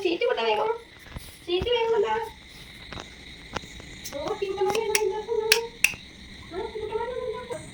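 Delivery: hushed, fading night voice. Real, not acted.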